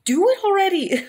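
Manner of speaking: high, loud yell of demand; retold yell. Patient yelling at the doctor to hurry, high loud yell. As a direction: shout it at the doctor in pain. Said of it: "I literally said".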